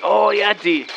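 ordinary delivery

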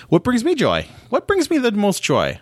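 very high mock voice asking about joy; squeaky put-on voice. Podcaster playfully squeaks the question to listeners, very high. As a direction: pitch way up like a cartoon.

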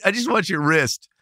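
amused, half-laughing retelling; savouring the line with a chuckle; laughing repeat of the quote. Retold or imitated. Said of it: "Isn't that great?".

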